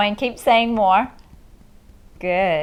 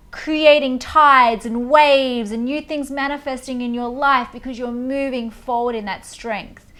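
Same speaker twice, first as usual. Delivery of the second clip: ordinary delivery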